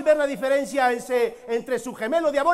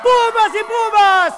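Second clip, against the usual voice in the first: slow booming ring call, high; lucha libre announcer; 5 semitones higher, 3 dB louder, slower than usual. Acted. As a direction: drag out each word for the arena. Spanish intro of Big Man.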